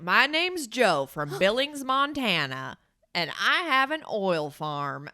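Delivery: big, slow, loud American drawl; imitated American accent. Retelling a German friend's American accent, hammed-up American. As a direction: overdo a hearty American accent. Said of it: "to do an American accent".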